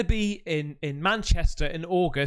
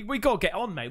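ordinary delivery

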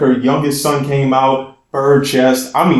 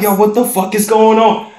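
higher, louder alarmed yo; alarmed disbelief; 8 semitones higher, 3 dB louder than usual. Retold or imitated. Caught in the middle of a street fight, blurting out in alarm. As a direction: blurt it like things just got out of hand.

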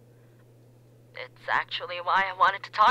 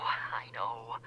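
whispering; 6 semitones lower, 6 dB quieter than usual. Acted. Caller whispers.

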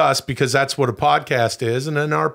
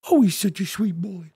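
put-on baby talk; 5 semitones higher than usual. Coos at dog.

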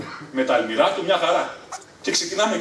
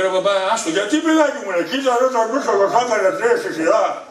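exasperated plea; 7 semitones higher than usual. Retold or imitated. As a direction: beg dad to drop it, giggling. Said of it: "Λέω".

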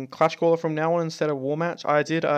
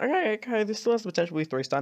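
hopeful excitement; 9 semitones higher, faster than usual. Retold or imitated. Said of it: "So, I'm like".

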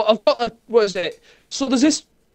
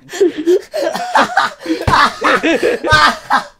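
loud laugh; 6 semitones higher, 8 dB louder than usual. Real, not acted. Reacting to the message.

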